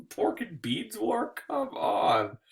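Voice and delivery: high, soft, drawn-out incredulous laugh; slow, high giggle of incredulity; laughing disbelief. Real, not acted. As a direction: laugh through the words in disbelief. Laughing disbelief at silly war name.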